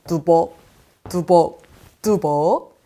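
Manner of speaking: low, punched step-by-step words; plodding footsteps. Acted. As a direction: stomp out each word like a footstep. (낮고 힘 있게 뚜벅뚜벅 걷는 소리 흉내)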